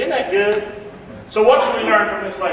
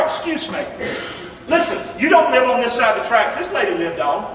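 normal talking voice

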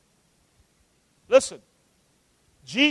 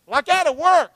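defensive retort; 8 semitones higher, 5 dB louder than usual. Acted. Father's acted retort in quarrel.

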